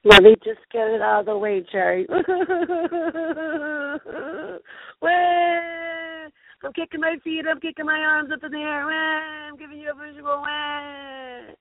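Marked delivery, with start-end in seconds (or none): 2.1-5.0 high quavering fake sob; exaggerated boo-hoo wail, played for laughs; drawn-out pretend bawling; mock crying. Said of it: "doesn't know why I'm crying". 5.0-11.6 high-pitched fake bawling; hammed-up crybaby whine; mock crying. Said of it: "doesn't know why I'm crying".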